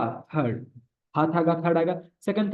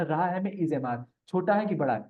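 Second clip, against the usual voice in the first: ordinary talk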